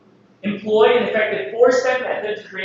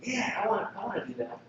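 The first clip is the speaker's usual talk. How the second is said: quiet eager reader voice; 13 dB quieter, faster than usual. Retold or imitated. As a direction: murmur it as a keen reader. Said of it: "when you read this".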